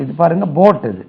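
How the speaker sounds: usual speaking voice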